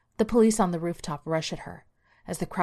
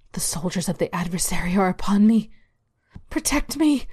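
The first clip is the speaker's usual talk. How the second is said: terrified plea; 2 semitones higher, 4 dB louder than usual. Acted. Karen pleads skyward in terror.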